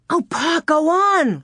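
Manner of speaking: high, impatient plea to keep the story going; eager cry. Acted. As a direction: beg him to go on, pitched high and loud. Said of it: "Laura cried".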